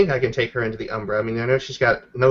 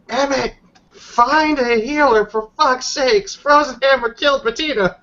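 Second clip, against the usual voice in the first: frantic howl; 12 semitones higher, 3 dB louder than usual. Acted. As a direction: shout it out as a panicked howl. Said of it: "Wait, that was a howl?".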